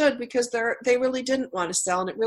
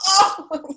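a high mock gasp, as if shot in the heart; mock wounded gasp; 10 semitones higher, 3 dB louder than usual. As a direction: pretend to be hurt, hammed-up. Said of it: "I'll go".

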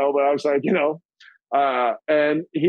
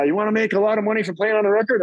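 higher, fast pitch of a deal; imitating Frankie; 7 semitones higher, faster than usual. Frankie offering paid session, fast and higher. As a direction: tempt him. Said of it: "He's like".

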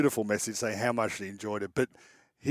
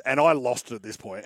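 usual speaking voice

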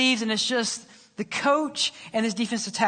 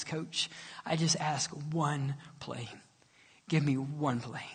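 tearful plea; 6 semitones lower, 9 dB quieter than usual. Acted. Tearful player pleads with coach.

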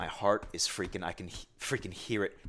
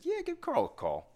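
quoted buddy in a much higher voice; high mock quote. Telling how the buddy said to call Carl, high voice. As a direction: toss it off high and light like the buddy.

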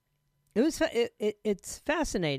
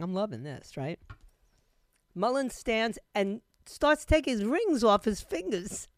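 ordinary talk

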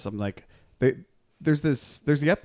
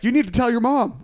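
mock urgent; 13 semitones higher, 6 dB louder than usual. Quoting characters pushing Michael.

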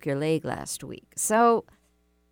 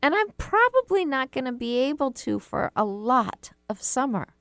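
whiny shame voice; 8 semitones higher than usual. Acted. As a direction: whine it out like a sulking inner critic. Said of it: "the shame voice comes back and goes".

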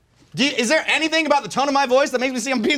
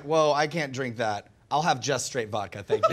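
imitating Michael; 11 semitones lower, 7 dB quieter than usual. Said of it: "And Michael was like".

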